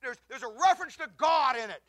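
loud, high, impassioned sermon delivery; full-force preaching. Acted. Pastor preaching hard to the congregation, loud and fervent. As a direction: thunder it from the pulpit.

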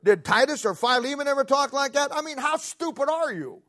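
high-pitched, incredulous rhetorical questions; preacher pressing one question after another; fired-up preaching. Real, not acted. Preacher fires rhetorical questions at congregation.

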